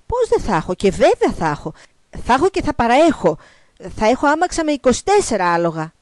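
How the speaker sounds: loud, high, emphatic promises; eager boasting frog. Acted. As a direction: outbid yourself to impress her. (φωναχτά και πομπώδη υποσχόμενα)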